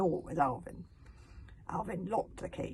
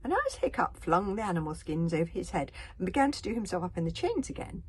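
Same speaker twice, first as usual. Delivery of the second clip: usual speaking voice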